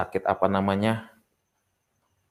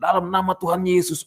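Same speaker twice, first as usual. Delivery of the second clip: high, hammed-up command; much higher declaiming prayer voice; re-enacted healing prayer; 10 semitones higher, 4 dB louder than usual. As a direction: act out a desperate blast of prayer.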